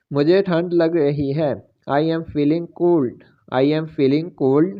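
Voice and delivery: normal talking voice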